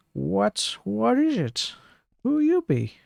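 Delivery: put-on high, slurred drunk voice, lost and confused; drunk man.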